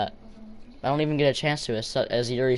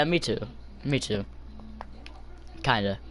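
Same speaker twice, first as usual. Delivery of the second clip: ordinary talk, nothing marked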